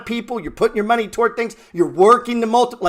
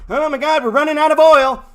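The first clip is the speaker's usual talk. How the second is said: higher and louder, mock-frantic; mock panic; 6 semitones higher, 6 dB louder than usual. Mocking seventies investors fearing a crash, hammed-up panic.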